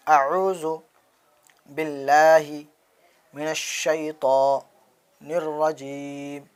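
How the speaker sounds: each word slow and apart, with pauses; slow recitation. Shown as a demonstration. Teacher leading the class in slow recitation. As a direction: recite slowly so students can follow. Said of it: "چلیے پڑھیے میرے ساتھ".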